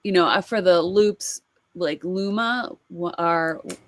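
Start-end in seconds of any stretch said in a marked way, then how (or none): none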